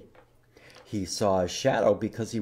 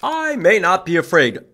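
groundhog voice; 7 semitones higher, 8 dB louder than usual. Acted.

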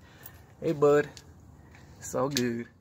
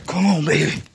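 urging the car; 6 semitones higher, 8 dB louder than usual. Real, not acted. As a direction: egg the car on loudly.

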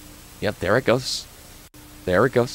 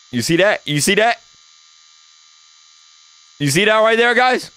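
high, insistent questioning; high-pitched startled urgency; excited alarm; 9 semitones higher than usual. Real, not acted. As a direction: urge viewers to look, climbing higher each time. Urging viewers to see eyeball.

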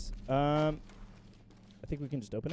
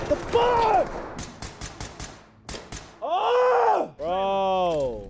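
sudden high yelp of shock; startled shout; 11 semitones higher, much slower than usual. Real, not acted. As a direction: jump and yell in surprise. Sudden enemy fire surprise.

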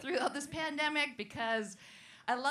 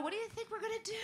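high cocky jockey; 10 semitones higher, 5 dB quieter, much faster than usual. Retold or imitated.

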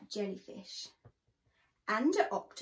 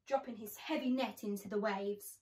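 ordinary delivery, nothing marked

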